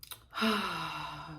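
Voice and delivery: long audible sigh. Shown as a demonstration. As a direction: let a slow breath go. Breath before a calming exercise.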